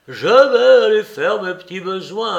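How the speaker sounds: imitation moqueuse, plus aiguë et plus forte; singe-le comme un gamin espiègle; air de jeune espiègle. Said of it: "avec des airs de jeune espiègle". (Larrier imite Soupe en gamin espiègle)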